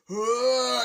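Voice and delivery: long, high, loud "ah"; drawn-out mock war cry; imitated battle cry. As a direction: yell as you leap in to attack. Mario leaps to attack.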